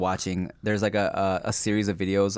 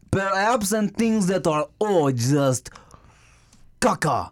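higher, hammed-up French accent; mock French accent; 9 semitones higher, slower than usual. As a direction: put on a silly French voice. Joking French voices.

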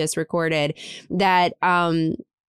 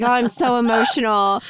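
retold emotional gush; 4 semitones higher than usual. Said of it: "I was, like, fully crying".

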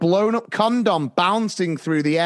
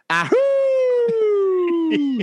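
much higher, held howl; wolf howl; 13 semitones higher than usual. Pretend. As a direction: howl like a werewolf. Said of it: "Right, who said it?".

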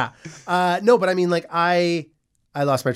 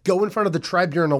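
ordinary talk

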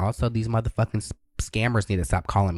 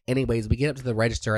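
ordinary talk, nothing marked

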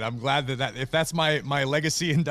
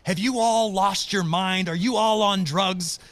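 loud, slow, high indignant caller; mock the furious woman on the line; imitating an outraged caller, louder and higher; 7 semitones higher, 5 dB louder, slower than usual. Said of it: "the woman says".

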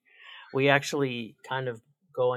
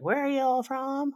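high mock Montana accent, puzzled; 13 semitones higher than usual. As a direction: play the clueless American from Montana. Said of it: "this is my greatest Montana accent".

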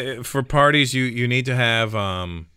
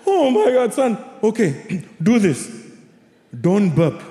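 high, put-on mother voice; imitated exasperated mom; 9 semitones higher, slower than usual. Mother advises son with reflux, high mock exasperation. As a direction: hand out advice as a fed-up mom. Mother answering child in retold story.

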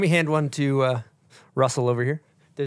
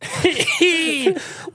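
surprised laugh; 13 semitones higher than usual. Real, not acted. Reacting to smelling salts.

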